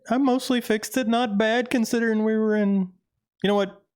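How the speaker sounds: squeaky high character voice; high-pitched voice. Acted. Repeats line in new voice.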